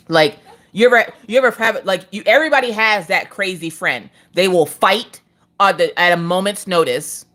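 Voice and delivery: ordinary talk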